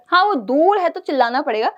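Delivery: normal talking voice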